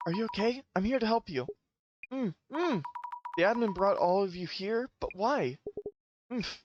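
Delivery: lightly voiced worried heroine; gentle heroine voice. Voices game heroine's lines.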